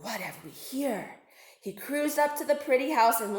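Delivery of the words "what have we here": low, soft predatory musing; a hungry shark purring to himself; sly shark thinking. Acted. Shark eyes a singing narwhal, low and soft. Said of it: "he thought".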